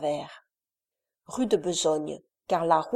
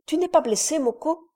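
higher, caring question over the wind; concerned boy; 8 semitones higher, 3 dB louder than usual. A narrator's reading. Briand asks Moko if he is hurt, concerned. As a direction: check on the cabin boy with quick concern. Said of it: "se tournant vers le mousse".

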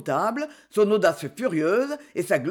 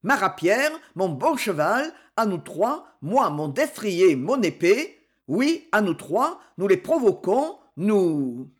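usual speaking voice

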